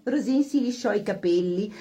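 ordinary delivery

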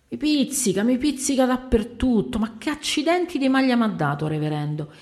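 high-pitched, agitated complaint; itchy complaint. Acted. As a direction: complain as if itching all over. Itching man complains in distress. (lamento acuto e smanioso)

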